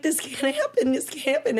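high, distressed retold plea; tearful disbelief. Newly diagnosed patient refusing the news, retold in tearful disbelief. Reliving her shock at diagnosis.

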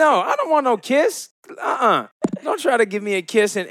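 high, squeaky playful protest; playful refusal. Real, not acted.

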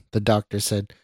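plain reading voice

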